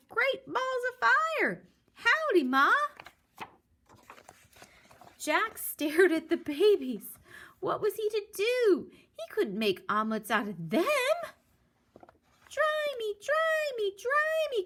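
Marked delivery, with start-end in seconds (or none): 0.1-2.7 put-on very high loud baby voice; baby goose. Baby goose greets Jack, high squeaky voice. 12.5-14.6 put-on very high whiny baby cry; crying baby chick. Soggy chick begs Jack, high whiny cry. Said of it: "cried the soggy baby chick".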